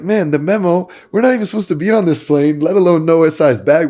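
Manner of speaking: high, loud, fast mock protest; acted-out indignant defense; exasperated retort. Retold protest against being chirped.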